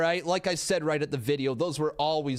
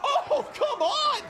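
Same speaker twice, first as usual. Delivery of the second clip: very high, loud, stretched yells; disbelieving shout; 3 dB louder than usual. Real, not acted. Streamer watching a crazy wrestling sequence, very high excited shouting.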